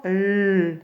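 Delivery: L held long as "lll"; isolated L sound. Shown as a demonstration. Teacher asking pupils where it is, long L. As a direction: draw out the lone consonant L. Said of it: "où c'est que j'entends L".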